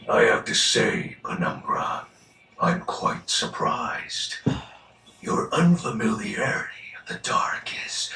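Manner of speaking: normal talking voice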